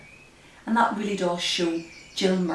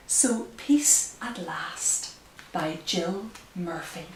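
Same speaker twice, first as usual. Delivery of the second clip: slow, hushed storybook reading; reading to children; 5 dB louder, slower than usual. Acted. Reads picture book aloud.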